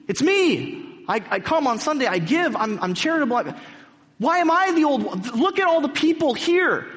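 high, loud, outraged protest; yelling at God. Retold or imitated. Mrs Turpin yelling at God, high and outraged. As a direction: hurl the questions up at heaven. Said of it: "she's yelling at God".

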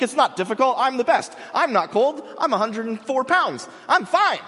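high and loud, hammed-up bravado; overplayed brave elf queen, ending in a high shout; mock Galadriel. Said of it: "She's like".

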